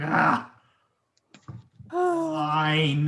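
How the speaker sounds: heavy sigh into a reluctant word; big sigh. Acted. Player acting Beck giving in to Mister Trent, big sigh. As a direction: let out a big acted sigh, then give in. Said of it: "does, like, a big sigh".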